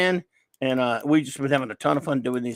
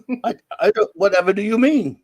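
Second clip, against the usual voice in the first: put-on playful Cajun accent, higher and louder, laughing through it; 5 semitones higher, 5 dB louder than usual. Coyly denying he does the Cajun accent. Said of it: "especially when it's got your Cajun accent".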